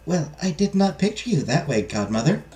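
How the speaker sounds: small, high voice with a questioning lift at the end; timid princess. Acted. Princess answers her unexpected godmother, timidly.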